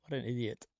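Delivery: usual speaking voice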